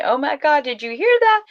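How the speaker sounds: high-pitched alarmed exclamation, retold; acted disbelief, voice rising high at the end; startled neighbor. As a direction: imitate an astonished neighbor, pitch leaping up. Neighbor's startled phone call, acted.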